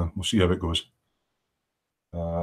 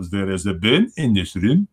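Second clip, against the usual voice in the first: German accent; 3 semitones lower than usual. Shown as a demonstration. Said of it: "you can pick up the accent".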